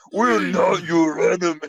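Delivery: high, drawn-out yelling impression; strained heroic yell, stretched out; mock scream. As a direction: imitate a silly overwrought scream. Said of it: "he just screams at him".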